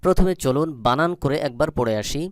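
ordinary talk, nothing marked

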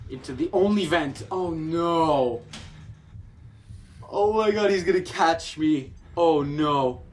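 high, loud panic; frantic fear at a chase; panicked. Real, not acted. Chased by monster, hiding.

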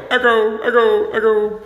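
high calls to test the echo; cave echo. Pretend. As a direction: sing out each word into an empty room. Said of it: "do you hear the echo?".

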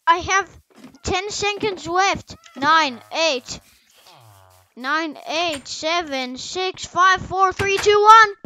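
mounting last-seconds excitement; urgent countdown. Real, not acted. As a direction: count down frantically to a shouted ONE.

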